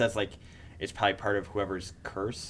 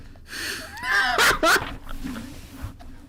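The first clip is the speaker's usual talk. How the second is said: shrieking laugh; 5 dB louder than usual. Real, not acted. Huge laugh at callback.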